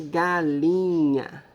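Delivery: "galinha" stretched out slowly by syllable. Shown as a demonstration. Teacher introducing the syllables to pupils, "galinha" said slowly. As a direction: draw out each syllable of "galinha". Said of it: "Primeira sílaba de galinha".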